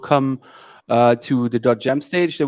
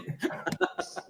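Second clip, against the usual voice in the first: kind gentle laugh; 14 dB quieter than usual. Real, not acted. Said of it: "I'm also laughing".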